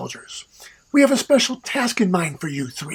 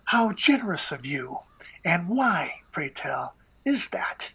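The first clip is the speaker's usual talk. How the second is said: dry hushed sarcasm; 7 dB quieter than usual. Acted. Dry suspicious question in hush.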